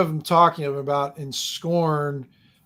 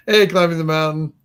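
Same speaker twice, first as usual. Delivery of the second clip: louder retake; 5 dB louder than usual. Shown as a demonstration.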